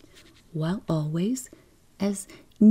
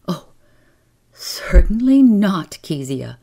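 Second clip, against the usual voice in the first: loud, emphatic refusal; firm mother; 3 semitones higher, 7 dB louder than usual. A narrator's reading. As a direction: put your foot down as a mother. Mother refuses her child's request.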